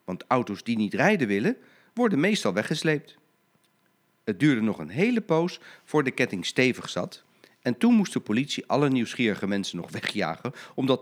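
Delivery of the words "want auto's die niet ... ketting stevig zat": plain reading voice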